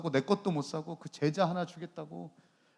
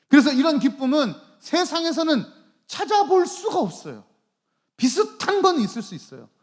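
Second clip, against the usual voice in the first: loud, higher, driving sermon delivery; forceful, urgent pulpit oratory; impassioned preaching; 6 semitones higher, 9 dB louder than usual. Real, not acted. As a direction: preach at full force, piling up the phrases.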